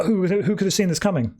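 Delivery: high-pitched mock astonishment; sarcastic surprise. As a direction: feign shock at the obvious twist. Sarcastic about predictable plot.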